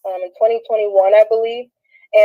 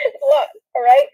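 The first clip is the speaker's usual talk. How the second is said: high laugh running into the words; high-pitched laughing speech. Real, not acted. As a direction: crack up, then talk through it. Laughs at her own painful story.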